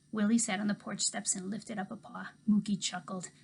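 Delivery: ordinary delivery, nothing marked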